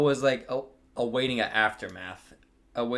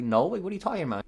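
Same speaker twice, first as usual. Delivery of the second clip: playing innocent; 8 semitones higher, faster than usual. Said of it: "I tried to play up the card".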